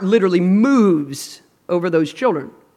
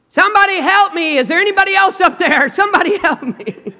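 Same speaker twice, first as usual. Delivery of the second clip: comic cry for help; 8 semitones higher than usual. Retold or imitated. Man calls for someone else.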